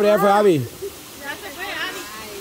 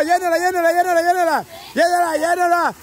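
high, insistent repeated chant; urgent cheering on; 5 semitones higher, much faster than usual. Real, not acted. Host urges players to fill it, excited chant. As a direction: keep driving them to the finish.

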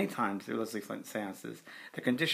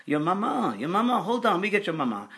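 put-on high, loud French-accented spirit voice; imitated Frenchman calling out; screaming Frenchman; 8 semitones higher, 7 dB louder than usual. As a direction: cry out urgently in a French accent. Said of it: "a Frenchman's voice came through".

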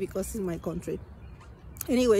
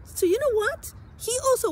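tearful outrage; 13 semitones higher than usual. Real, not acted. Betrayed mother telling how the father wanted to name the baby. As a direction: voice breaks high into a cry.

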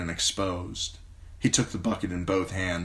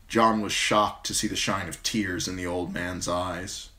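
ordinary delivery

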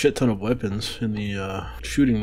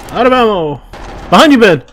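loud urgent warning; shout; 11 semitones higher, 12 dB louder, faster than usual. Real, not acted. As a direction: yell the warning. Combat warning callouts.

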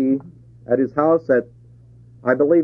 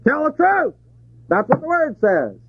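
sharp, much higher call-out; rebuke; 11 semitones higher than usual. Retold or imitated. Believer confronting a lying neighbor, high sharp rebuke. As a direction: call them out on the lie. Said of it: "You say".

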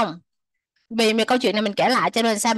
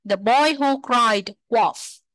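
slow model reading; much slower than usual. Shown as a demonstration. Teacher reads title slowly for students. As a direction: read the title slowly, word by word. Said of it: "teacher models the title".

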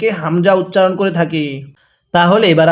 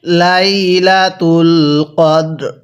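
slow, drawn-out melodic tilawat; Quran recitation; much slower than usual. Acted. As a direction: recite with long, measured vowels. (ধীরে সুর করে কুরআন তিলাওয়াত)

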